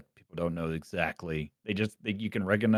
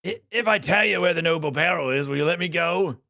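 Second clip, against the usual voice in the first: high, loud, urgent prisoner voice; captive bargaining for release; 12 semitones higher, 7 dB louder, faster than usual. Acted. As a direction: plead loudly in a raised pitch to be freed. Voices captive bandit pleading to go.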